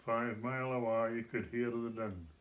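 Doric recited in broad Scots. Shown as a demonstration. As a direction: recite the verse in thick Doric. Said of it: "Doric Scots".

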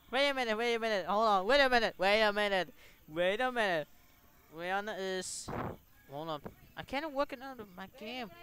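ordinary talk

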